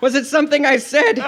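hammed-up worried puppet boy; crying out. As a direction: plead it in comic dismay. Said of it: "he cried".

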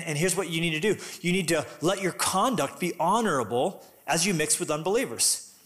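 ordinary delivery

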